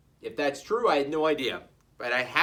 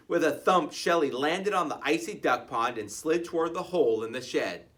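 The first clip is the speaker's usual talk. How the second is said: plain reading voice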